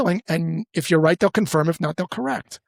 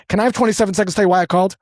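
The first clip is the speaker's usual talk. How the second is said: fast, louder pressured pitch; pushy sales pitch; 3 semitones higher, 3 dB louder, much faster than usual. Retold or imitated. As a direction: rush the ask like a pushy rep. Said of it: "you can feel the pressure there".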